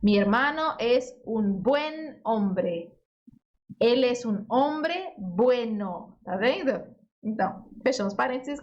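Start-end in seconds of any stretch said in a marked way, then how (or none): 0.0-2.9 Mi hermano es un buen hombre, slow and clear; slow model reading. Shown as a demonstration. Teacher modelling buen before the noun slowly. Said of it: "buen antes". 3.8-6.2 Él es un hombre bueno, very slow and clear; slow model reading. Shown as a demonstration. Teacher modelling bueno after the noun slowly. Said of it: "ele vai depois".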